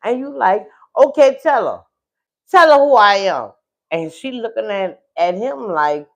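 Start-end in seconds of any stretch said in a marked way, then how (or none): 1.0-3.5 very loud, forceful confrontation; insistent demand. Acted. Wife demands he explain her to the other woman, loud. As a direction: hammer it out loudly, demanding he speak up. Acting out wife's confrontation at door.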